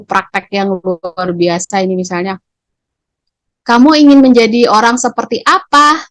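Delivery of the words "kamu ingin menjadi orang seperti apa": higher, louder, judging; teacher questioning harshly, judging the pupil. Shown as a demonstration. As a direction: fire the question like an accusation. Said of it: "kalau kita keras bertanya".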